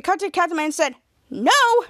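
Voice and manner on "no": high, loud outburst; mock shouted refusal; retold refusal. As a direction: imitate a stubborn hero snapping back.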